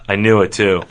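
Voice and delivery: subdued self-reproach. Acted. Retake of the line after interruption.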